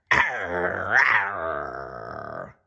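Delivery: low growling dog snarl. Acted. The farm dog snarls at Blinky, low growl. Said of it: "bared its teeth and snarled".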